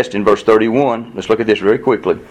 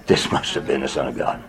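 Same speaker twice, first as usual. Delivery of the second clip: whispered, much softer, in awe; awed whisper; 10 semitones lower, 8 dB quieter than usual. Retold or imitated. The centurion at the cross whispers in awe. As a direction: breathe it like a revelation.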